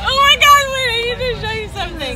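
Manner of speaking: high, excited squeal. Real, not acted. Sudden excited discovery.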